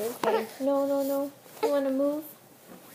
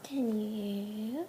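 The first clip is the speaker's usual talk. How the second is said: usual speaking voice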